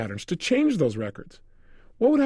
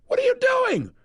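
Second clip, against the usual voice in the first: much higher, shocked mock shout; startled outcry. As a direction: yelp it like cold water just hit you. She jumped about four feet.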